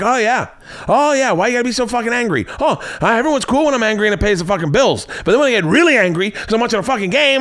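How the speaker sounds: high-pitched angry rant, impersonated; Burr. Retold or imitated. Comedian answering complaints about his anger, indignant high rant. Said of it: "And Burr's like".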